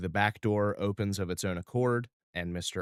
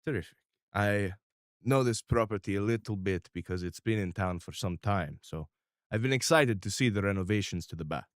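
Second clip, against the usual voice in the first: crime boss; 4 dB louder than usual. Acted. GM holds Mister R voice.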